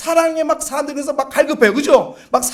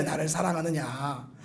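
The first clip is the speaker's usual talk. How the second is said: hushed slow echo; 11 semitones lower, 16 dB quieter than usual. Acted. Preacher repeats the Lord's question.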